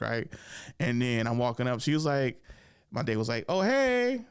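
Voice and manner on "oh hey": high, loud-ish singsong hello; imitated girl's greeting. Date running into the other girl, high imitated greeting. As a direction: mimic a girl's pitched-up greeting.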